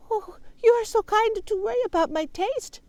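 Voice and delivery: high quavering turkey voice near tears; teary turkey. Acted. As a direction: gush with nervous relief. Turkey relieved, tearful.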